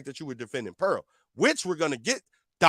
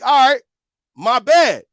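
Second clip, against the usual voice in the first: louder, higher retold concession; mildly exasperated retold apology; emphatic concession; 6 semitones higher, 10 dB louder, slower than usual. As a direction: throw up a loud, exasperated apology. Retold concession during an argument.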